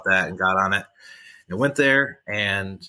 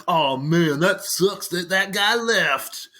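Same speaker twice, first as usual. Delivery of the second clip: higher, slow, hammed-up disappointment; mock bummed out; 9 semitones higher, much slower than usual. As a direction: drag it out like a sad sack pretending to care. Imagined disappointed inner voice.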